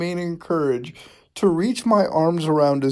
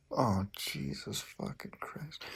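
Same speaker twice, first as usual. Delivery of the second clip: much softer, slow groan of disbelief; breathy cringing near-whisper; pained hush; 14 dB quieter, slower than usual. Real, not acted. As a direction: sink into embarrassed dismay. Cringing at "yes Daddy.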